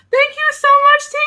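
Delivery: high, loud gushing squeal; excited thanks. Real, not acted. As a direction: squeal your thanks to a friend. Thanks friend for live chat message.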